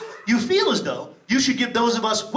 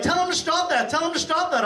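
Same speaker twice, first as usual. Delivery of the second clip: mock horrified wife; 7 semitones higher than usual. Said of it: "My wife's horrified".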